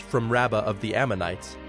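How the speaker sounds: ordinary talk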